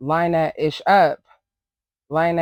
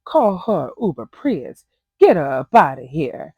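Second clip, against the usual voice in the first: mocking Mariah's cheesy brag, then a louder punchline; cheesy confessional mock; 7 dB louder than usual. Recapper mocks Mariah's confessional brag in a hammed-up voice. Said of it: "Mariah's confessionals are so cheesy".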